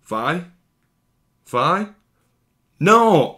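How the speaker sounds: building from a small call to a high, loud despairing cry; imitating Jinx's cry as she realizes Vi is gone. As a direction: call for her sister, then break into a cry. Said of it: "Jinx being like".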